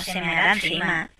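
quiet resigned aside; muttered repeat. Real, not acted. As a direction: say it again under your breath. Repeats the worry to herself.

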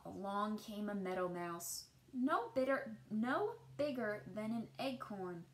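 put-on gentle read-aloud voice for children; storybook narration. A narrator's reading. Teacher reading a picture book to children, gentle storytelling. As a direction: tell the tale as if to a class at storytime.

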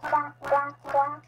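short run of duck quacks; Mommy Duck quacking. Acted. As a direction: pretend mother duck calls. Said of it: "Okay, I'm Mommy Duck".

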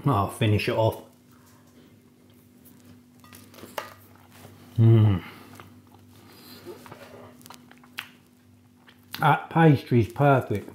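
muffled by food; chewing while talking. Real, not acted. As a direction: mouth partly full. Seen 2: Lips closed, jaw working after bites; crumbs brought to mouth during words.

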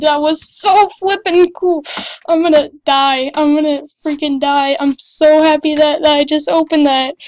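overjoyed, loud and emphatic; thrilled. Real, not acted. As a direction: gush like you just hit the jackpot. Thrilled by lucky case opening.

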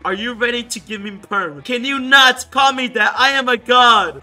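mock outrage building to loud; mad. Reading the angry self-styled god's message, mock outrage. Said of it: "he gets mad over the word noob".

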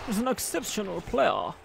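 imitated, overdone German accent; half-successful mock German accent; bad German accent. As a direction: mimic a commentator with a clumsy German accent. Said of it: "He said in an overly German accent".

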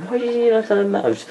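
high-pitched mock questioner; imitated puzzled viewer. Voicing a viewer who questions his mouse, high mock-puzzled voice. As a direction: put on a high voice for a doubting viewer. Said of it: "You're probably thinking".

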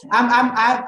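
real laugh breaking into stammered words. Real, not acted. Amused by colleague's interjected no.

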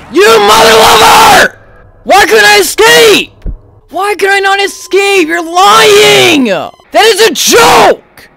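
high, loud furious yells; real fury after being downed; furious shouting. Real, not acted. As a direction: rage at the game. Raging after dying.

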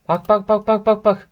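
teasing repeated look-look; mock excited bak. Real, not acted. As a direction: point and jeer, sing-song. Mocking a commenter's video.

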